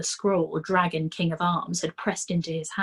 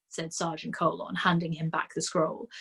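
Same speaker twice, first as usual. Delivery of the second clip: usual speaking voice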